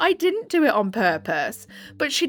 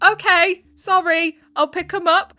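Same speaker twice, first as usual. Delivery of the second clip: put-on higher, louder little-kid answer; child voice; 6 semitones higher, 4 dB louder than usual. A light take on the kid answering her mum. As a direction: play the obedient eight-year-old. Voicing her eight-year-old.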